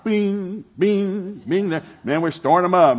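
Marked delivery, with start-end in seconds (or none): 0.0-1.8 high playful bing bing bing; imitating a phone camera sound; camera click noises. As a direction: chirp each bing like a phone shutter. Mimicking phone camera snaps.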